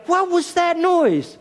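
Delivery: father pretending he heard a strange noise; mock puzzled. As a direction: play dumb for the kids, hammed up. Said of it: "and you go".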